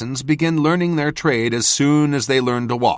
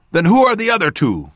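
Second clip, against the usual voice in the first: higher, louder demand; 8 semitones higher, 6 dB louder than usual. A narrator's reading. Cadal confronting the narrator about the twins, demanding. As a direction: insist on an answer now. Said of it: "Cadal demanded".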